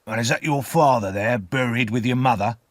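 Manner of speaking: rough man's probing question; rough convict. A narrator's reading. Convict asks about the buried father, rough. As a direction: question the child as a coarse convict.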